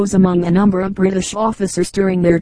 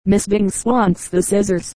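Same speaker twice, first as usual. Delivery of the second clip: plain reading voice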